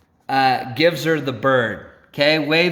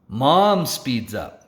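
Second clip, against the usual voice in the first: ordinary delivery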